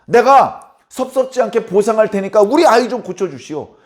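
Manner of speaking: loud, high, lordly rich woman ordering a healer; wealthy lady barking for a cure; haughty demand. Retold or imitated. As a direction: talk down to him, as if paying for a service. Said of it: "이런 태도를 취하는".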